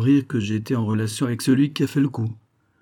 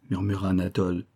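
usual speaking voice

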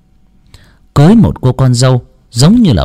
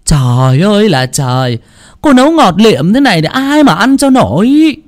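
roaring mother-in-law; 10 semitones higher than usual. A narrator's reading. Mother-in-law roars at her daughter-in-law about the sweet soup. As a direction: scream at her over the soup. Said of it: "mà gầm lên".

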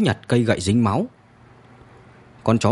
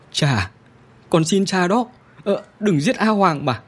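put-on boy begging his father, pitched much higher; tearful pleading; 7 semitones higher than usual. A narrator's reading. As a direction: plead through tears, voice climbing. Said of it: "khóc lóc thảm thiết". (cậu bé khóc lóc van xin cha, giọng cao hẳn lên)

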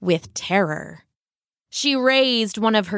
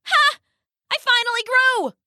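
high, fast triumphant whoop; gleeful shout; 14 semitones higher than usual. Acted. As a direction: crow with delight. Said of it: "he said, spinning around".

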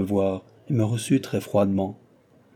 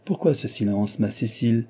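ordinary delivery, nothing marked

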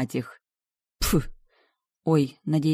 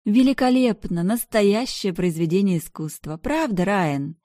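high, loud gushing praise; gushing socialite; 5 semitones higher, 4 dB louder than usual. Acted. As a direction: gush over the dress theatrically. Bianca gushing to Ryan.

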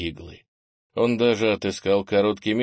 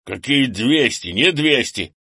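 louder, higher objection; indignant denial; 4 semitones higher, 6 dB louder than usual. A narrator's reading. Grandfather disputes his grandson's guess, indignant. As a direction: deny it with huffy force.

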